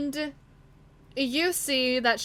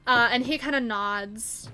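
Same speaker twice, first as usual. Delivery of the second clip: normal talking voice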